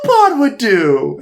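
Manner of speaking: very high, slow, gleeful exclamation; mock excited. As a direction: squeal it out like a delighted fan. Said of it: "Which is like".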